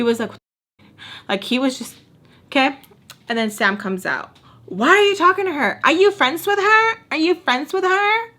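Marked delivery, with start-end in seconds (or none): 4.7-8.3 high, loud imitated accusing questions; mock interrogation. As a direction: grill someone in a raised, pitched-up mimicking voice. Acts out Sam's jealous interrogation.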